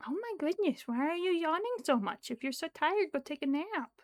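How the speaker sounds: high, swooping pet-talk scolding; mock scolding in a high lilt; playful scolding. Real, not acted. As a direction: tease a sleepy cat up high. Playfully scolding the yawning cat.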